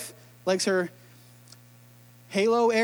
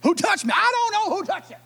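high mocking echo of Who touched me; fed up, mimicking the question; exasperated disciple mockery; 9 semitones higher than usual. Disciples mocking Jesus's question, high and exasperated. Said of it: "disciples sometimes are just like".

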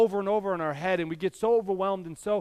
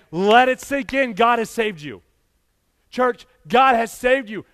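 full-force preaching; 4 semitones higher, 5 dB louder than usual. Acted. Pastor urging church to let salvation sink in, loud preaching. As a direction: press it home, let it land.

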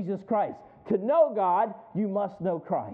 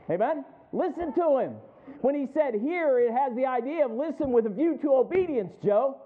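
raised, louder preaching voice; impassioned preaching; 6 semitones higher, 5 dB louder than usual. Real, not acted. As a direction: preach it with building force, pitched up. Preaches with rising force.

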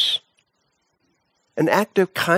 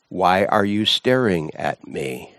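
low-voiced Seton; 7 semitones lower than usual. A narrator's reading. Prisoner Seton answers the enforcer.

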